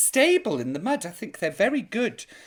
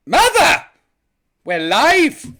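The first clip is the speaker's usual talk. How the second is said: calling out; 8 semitones higher, 11 dB louder, slower than usual. Real, not acted. Calling out to her mother.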